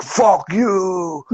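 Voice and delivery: put-on high, drawn-out French-accented slur; mock French-Canadian drunk. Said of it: "has, like, a French accent".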